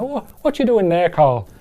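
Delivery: unconvincing Irish lilt, starting high; bad Irish accent. Shown as a demonstration. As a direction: have a poor stab at an Irish accent. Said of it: "sort of Irish or something".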